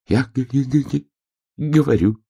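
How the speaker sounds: high, shivering stammer; stuttering. A narrator's reading. Hedgehog answers bear cub under hail, stuttering. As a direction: stammer repeatedly on the first sound.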